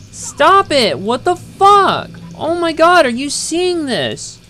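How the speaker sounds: real loud, high shouts at the game, slow and dragged; furious yelling. Real, not acted. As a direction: scream at the screen in disbelief. Game misbehaving, player yelling.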